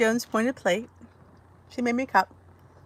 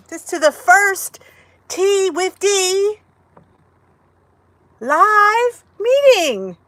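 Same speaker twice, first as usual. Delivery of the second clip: grand announcement; 9 semitones higher, 3 dB louder, much slower than usual. Real, not acted. Announcing the show title.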